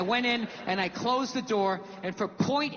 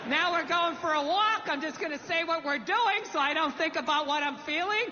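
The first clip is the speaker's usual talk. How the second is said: higher, over-cheery show host patter; weird game show host; 4 semitones higher than usual. Retold or imitated. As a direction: play a fake-upbeat game show host. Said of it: "like some weird game show host".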